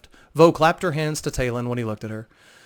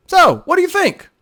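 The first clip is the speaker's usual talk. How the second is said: much higher and louder, a playful reveal; Vo's true voice; 10 dB louder than usual. Acted. Vo revealing herself to Talon after the disguise, playful and bright. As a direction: unveil yourself with a teasing flourish. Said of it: "Her voice returned to her normal one".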